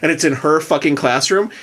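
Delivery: ordinary talk, nothing marked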